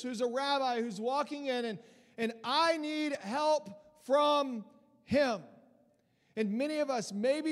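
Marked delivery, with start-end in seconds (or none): none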